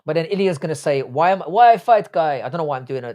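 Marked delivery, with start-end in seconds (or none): 1.0-2.4 slow, louder put-on foreign accent; half-hearted foreign accent, drawn out and raised; Ilya, accented. Streamer imitating a fighter refusing a match, in a put-on accent. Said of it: "doing that accent".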